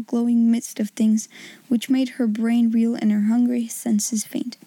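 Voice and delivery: plain reading voice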